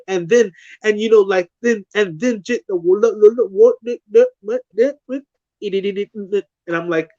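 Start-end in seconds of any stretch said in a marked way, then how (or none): 2.4-6.4 high nonsense babble; gibberish. Retold or imitated. Retelling somebody talking to them, imitated gibberish. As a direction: rattle off meaningless syllables like garbled speech. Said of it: "but it's gibberish".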